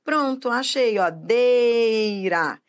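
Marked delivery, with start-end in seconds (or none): 1.1-2.6 "Deira" with DEI stretched; drawn-out "Deira"; stressed syllable demo. Shown as a demonstration. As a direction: hold the DEI of "Deira" long. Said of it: "É a sílaba que manda na palavra".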